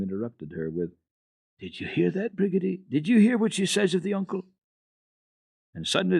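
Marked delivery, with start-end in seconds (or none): 1.6-4.5 put-on high, lively old woman; excited, sharing her joy; delighted grandmother. A narrator's reading.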